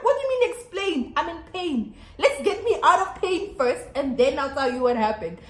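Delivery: high, indignant acted-out retort; indignant plea, easing toward the end; retold anger. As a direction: snap back in mild re-enacted exasperation. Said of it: "I was so mad".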